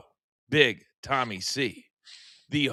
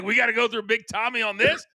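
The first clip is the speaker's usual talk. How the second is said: shouted fast and higher, playing the indignant callers; mock outraged shout; 6 semitones higher, faster than usual. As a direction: blurt it out fast, like a fed-up caller. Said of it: "They're like".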